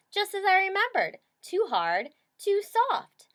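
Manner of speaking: put-on high, loud fussy old lady voice; fussy old woman judging the beds; prissy high Goldie. A narrator's reading. Goldie fussing over the beds.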